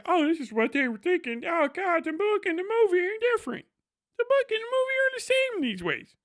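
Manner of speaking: high-pitched, climbing mock realization; mocked video essayist. Critic discovering book versus movie, high mocking voice. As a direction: parody a naive critic in a rising falsetto. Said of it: "get inside the head of this guy and go like".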